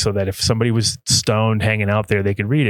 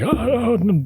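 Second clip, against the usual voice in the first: high flustered oh's; nervous Alan; 11 semitones higher, slower than usual. Retold or imitated. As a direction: sound caught out and flustered. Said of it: "he's like".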